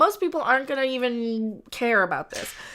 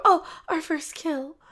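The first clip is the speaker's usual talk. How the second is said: lightly voiced, slow and higher, dreamy; mock gushing lovers; 5 semitones higher than usual. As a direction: coo it like a proud newlywed. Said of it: "They don't go".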